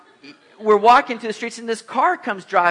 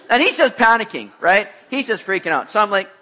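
normal talking voice

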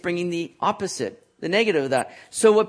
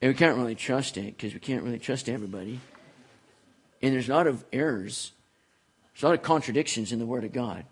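lower, mumbling mock of a doubter; mock a dismissive sceptic, lower and muttered; 7 semitones lower, 4 dB quieter than usual. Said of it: "how the world loves to say".

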